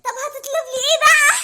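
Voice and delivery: put-on a delighted girlfriend gushing at her date; girly Mona voice. Speaker plays girlfriend Mona.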